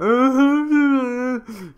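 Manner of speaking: loud, high, drawn-out fake wail; exaggerated bawling imitation; mock crying. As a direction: wail like a big baby, mockingly. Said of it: "crying their heads off".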